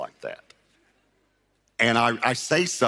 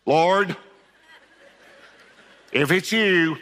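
Peter's bold dare; 6 semitones higher, 4 dB louder, slower than usual. Retold or imitated. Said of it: "And Peter said".

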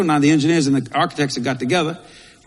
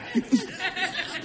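laugh; 10 dB quieter than usual. Real, not acted. Laughing after calling Scarlett.

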